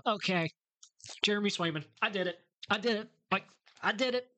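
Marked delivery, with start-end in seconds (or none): 1.2-4.3 excited. Real, not acted. Finally lands the goalie he wanted.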